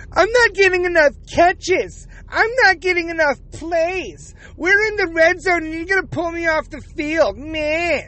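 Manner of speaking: high, whining mock voice of a sulking player; whiny mock Kelce. Mocking the tight end complaining to his coach, high and whiny. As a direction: whine like a spoiled kid denied the ball.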